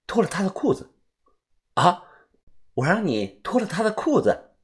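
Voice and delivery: plain reading voice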